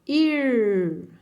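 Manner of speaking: IR drawn out with a retroflex R; caipira R. Shown as a demonstration. Teacher reading I plus R, caipira R in "ir". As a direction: hold "ir" on a curled-tongue R. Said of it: "vai fazer o som caipira".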